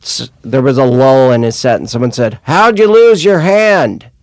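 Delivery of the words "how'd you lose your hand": high, loud, slow heckle; heckler voice. Retold or imitated. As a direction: call it out like a drunk heckler. Heckler quoted.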